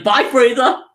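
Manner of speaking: high, loud, cheeky farewell; Goku's taunt. Retold or imitated. Goku teasing Frieza as he teleports away, high and loud. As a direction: chirp a bright mocking goodbye. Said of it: "And he's like".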